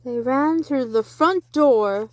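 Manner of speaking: plain reading voice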